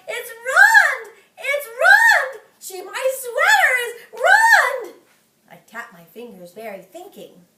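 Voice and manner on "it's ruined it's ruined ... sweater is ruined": high, loud crying wail. Acted. May wailing that her sweater is ruined, high and loud. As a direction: sob out the loss of the sweater. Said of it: "she yelled".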